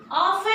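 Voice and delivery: "Often" pronounced OFF-en; silent T. Shown as a demonstration. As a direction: drop the T in "Often". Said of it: "I am not pronouncing T".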